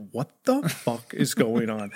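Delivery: low, slow, hushed disbelief; stunned disbelief. Retold or imitated. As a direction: draw it out low, slowly taking in the outrage. Baffled by teacher's refusal.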